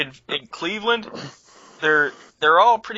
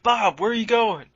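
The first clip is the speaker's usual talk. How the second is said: raised worried call; concerned call; 7 semitones higher than usual. Retold or imitated. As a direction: call out after a wandering friend. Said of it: "I was like".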